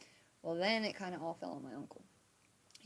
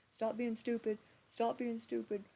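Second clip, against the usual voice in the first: imitating cops scolding; 4 semitones higher than usual. Cops scolding her in car.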